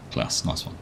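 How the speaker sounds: breathy whispered "nice one", almost no voice; whispered aside. Real, not acted. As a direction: mutter it under your breath to yourself. Repeats reader's comment, then again.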